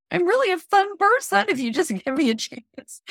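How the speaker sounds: high-pitched pleading that breaks into laughter; squealed, laughing self-parody; laughing mock plea. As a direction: beg to be liked, cracking up at yourself.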